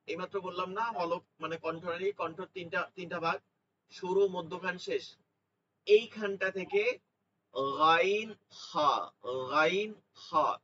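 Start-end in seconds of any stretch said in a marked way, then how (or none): 5.9-10.6 গাইন হা drawn out slowly from the throat; slow demo of letters. Shown as a demonstration. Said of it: "এই দুই হরফই". (ধীরে কণ্ঠের শেষ থেকে গাইন হা)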